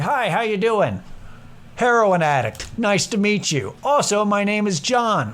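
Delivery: lightly hammed-up greeting; mock sales pitch.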